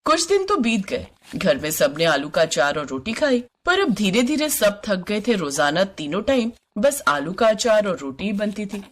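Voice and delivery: usual speaking voice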